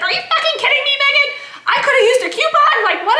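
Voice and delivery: very high, incredulous tirade; mock outrage, squeaking upward; outraged husband. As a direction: imitate exasperated disbelief, close to laughing. Husband outraged at cost, retold.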